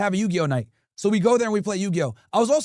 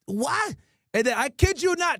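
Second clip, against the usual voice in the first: ordinary delivery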